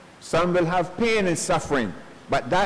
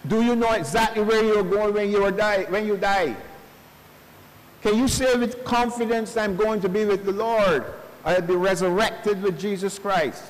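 retold funeral preaching; 5 semitones higher than usual. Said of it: "and I said".

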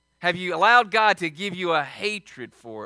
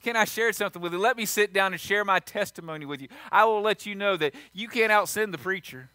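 ordinary delivery

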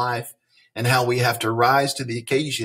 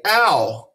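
pinched "ow"; 7 semitones higher, 5 dB louder than usual. Shown as a demonstration.

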